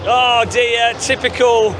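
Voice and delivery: ordinary talk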